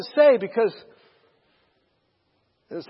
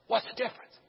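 whispering; 9 dB quieter than usual. Retold or imitated. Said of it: "whispering to each other again".

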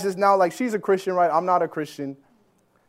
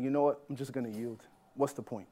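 1 resigned thought; 7 semitones lower, 12 dB quieter than usual. Retold or imitated.

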